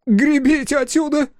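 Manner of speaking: panicked high yell. Acted. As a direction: scream at the rowers to row. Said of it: "заорал он".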